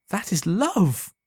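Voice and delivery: high, slow, carried away; rapturous cry. A narrator's reading. Alan to the old man, slow rapturous cry. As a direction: cry it out in wonder. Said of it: "cried Alan".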